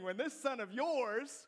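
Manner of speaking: mock disdainful sibling; scornful brother. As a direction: draw it out with contempt. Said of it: "the brother does the same thing".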